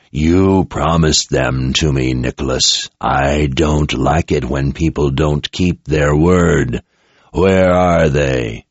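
slow, low, cold threat; menacing tyrant. Acted. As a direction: lean on each word like a veiled threat. Possessor Jagang threatening Nicholas.

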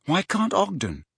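high-pitched, mildly puzzled question; slightly nonplussed. A narrator's reading. Boy asking his mentor, slightly nonplussed. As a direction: ask it with a touch of bafflement. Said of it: "slightly nonplussed".

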